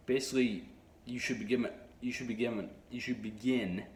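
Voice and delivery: stumbling restarts of the same phrase; stuttering. Real, not acted. Vlogger giving viewers an actionable step, stuttering. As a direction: get stuck and keep restarting the sentence. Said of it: "Sorry, I'm stuttering a lot".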